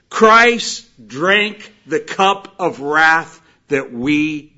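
loud, slow, emphatic sermon voice; preaching. Acted. Preacher proclaiming Christ as substitute, slow forceful preaching. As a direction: hammer out each phrase to the congregation.

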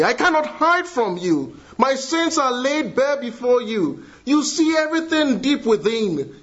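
voicing Hagar's prayer from the pulpit; fervent preaching. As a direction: preach it with urgency.

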